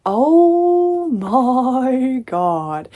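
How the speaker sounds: long, drawn-out loud wail of exasperation; exasperated outburst. Real, not acted. Reader fed up with this chapter, drawn-out exasperated cry. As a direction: stretch every word, high and loud. Venting about chapter that angered her.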